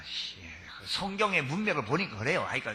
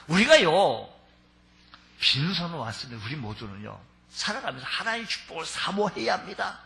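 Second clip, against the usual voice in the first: ordinary talk, nothing marked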